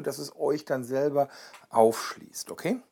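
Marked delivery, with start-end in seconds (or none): none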